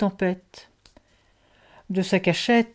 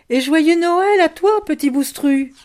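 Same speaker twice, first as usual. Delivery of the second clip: high, loud call into the distance; 9 semitones higher, 6 dB louder than usual. A narrator's reading. Loupiote calls toward the dark manor, crying out. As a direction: cry the greeting out so it carries. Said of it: "elle cria".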